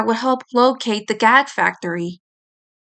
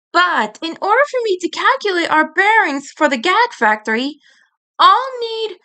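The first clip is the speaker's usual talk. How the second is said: high, fussy know-it-all voice; prim scolding boy; 7 semitones higher than usual. Acted. Edd chiding friends for roughhousing, prim and high. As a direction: scold them like a prissy schoolboy.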